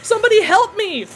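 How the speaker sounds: high, loud desperate cry; cried out. Acted. Garrett beaten on the ice, desperate high cry. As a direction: scream for help. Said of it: "Garrett cried".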